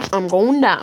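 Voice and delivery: loud cry of falling. Real, not acted. Falling in the game.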